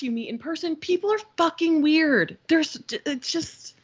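ordinary delivery

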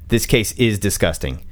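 usual speaking voice

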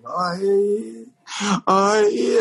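long high wail, imitating mourners; mock keening from a grieving audience; imitated crying wail. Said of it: "they're, they're, they're crying".

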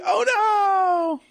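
high, drawn-out cry of dismay; hammed-up panicked wail; mock alarm. Podcaster acting out the knock on the door, mock alarm.

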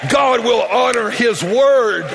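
slow, drawn-out proclamation; preaching shout. Real, not acted. Preacher promises the congregation a breakthrough, emphatic shout. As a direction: declare it with full-voiced conviction.

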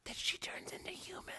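gasp, then whisper it; hushed whisper of spooky revelation; mock-awed whisper. Gasped whisper at spooky idea.

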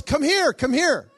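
loud, high repeated summons; urgent calling back. Retold or imitated. Patient calling the visitor back in, loud urgent summons. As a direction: call out to stop someone leaving.

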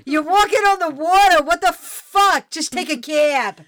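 loud, incredulous apostle; mock exasperated shout. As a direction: scold him loudly. Apostle scolding Jesus.

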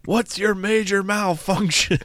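high-pitched, drawn-out drill sergeant impression; mock drill-sergeant yell, slow and high; R. Lee Ermey. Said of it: "a true drill sergeant as".

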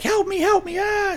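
high-pitched mock yell for help; imitated panicked scream. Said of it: "she yells out".

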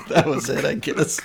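ordinary delivery

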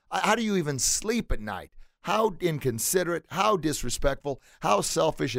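high-pitched, indignant scolding; townsperson yelling out. Acted. Townsperson scolding two riders on one horse, indignant mock yell. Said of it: "another townsperson yells out".

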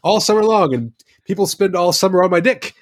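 high, fast hammed-up Kiwi voice; mock Kiwi accent. Mocking a Kiwi commercial, in a high hammed-up Kiwi accent. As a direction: ham up a Kiwi deck polish ad. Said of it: "that's a very Kiwi way of saying it".

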